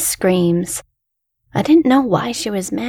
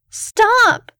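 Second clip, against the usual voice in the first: put-on high, piercing scream of a child; 13 semitones higher, 3 dB louder than usual. A narrator's reading. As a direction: scream it much higher. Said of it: "I screamed".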